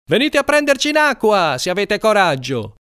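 high-pitched yelled challenge, easing at the end; taunting shout. A narrator's reading. Fleeing boys challenge their enemies from the water, taunting shout. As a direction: dare them from a distance.

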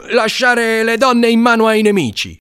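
loud, high, scornful yell; shouted reproach. A narrator's reading. Carruba shames fleeing boys for leaving a girl, high scornful shout. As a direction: yell it after the runaways. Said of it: "gridò Carruba dietro ai fuggiaschi".

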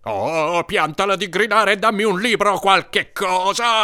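high, drawn exasperation; exasperated groan. A narrator's reading. Brother wants screaming stopped and a book, exasperated.

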